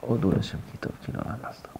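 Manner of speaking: soft repeat. Shown as a demonstration. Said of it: "Léat, léat".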